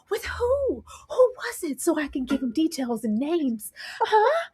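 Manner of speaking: high, eager prying; nosy eagerness. Retold or imitated. Eleanor prying for names, high and eager. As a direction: dig for the juicy details.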